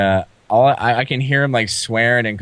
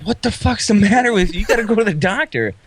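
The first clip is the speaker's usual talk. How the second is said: 1 scolding friends; 9 semitones higher than usual. Retold or imitated. Everyone scolds him about wound.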